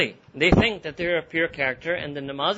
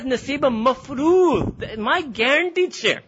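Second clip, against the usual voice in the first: higher, slow, gloating devil voice; Iblis boasting; 7 semitones higher, much slower than usual. Acted. Iblis claiming his share of people from Allah, slow and gloating. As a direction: savour "my guaranteed share" as if claiming a prize. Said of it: "That's how he's talking".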